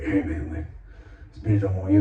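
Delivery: mock raspy warning to a rival. Man confronting the ex-boyfriend, imitated raspy voice. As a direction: imitate a raspy tough guy, lightly. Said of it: "raspy, like, the D O C type voice".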